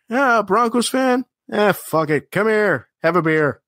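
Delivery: a hint of a beer guy shrugging and inviting; friendly beer guy. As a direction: wave the rival fan over, lightly acted. Retelling beer guy's invitation.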